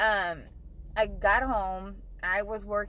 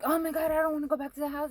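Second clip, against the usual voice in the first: much higher and fast, frightened plea; scared pleading; 7 semitones higher, faster than usual. Retold or imitated. Telling the police she won't go back, a fast scared high plea. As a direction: rush it out, too scared to go home.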